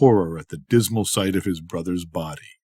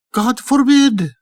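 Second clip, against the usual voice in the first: much higher, slow and loud protest; God forbid, protesting; 5 dB louder, slower than usual. A narrator's reading. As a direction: Marjana exclaims, high and drawn out. Marjana reassures.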